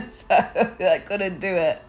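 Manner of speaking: laughing it out slowly. Real, not acted. Nail artist laughing at her swollen thumb, slow and giggly. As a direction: giggle through the admission.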